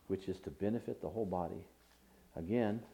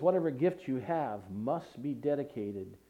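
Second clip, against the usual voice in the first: usual speaking voice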